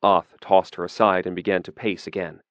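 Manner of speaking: plain reading voice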